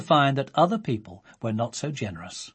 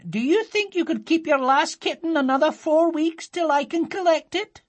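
much higher, lively aunt voice; 11 semitones higher, 4 dB louder than usual. A narrator's reading. An aunt on the phone asking Sophie to keep a kitten, high lively voice. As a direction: chatter brightly like a cheerful aunt.

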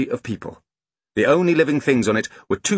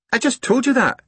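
put-on high, put-upon child; exasperated small boy; 3 dB louder than usual. A narrator's reading. Small boy tired of explaining to sister, exasperated. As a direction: protest in a high little-boy voice. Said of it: "Angus sighed".

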